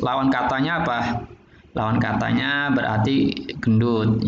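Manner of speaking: ordinary delivery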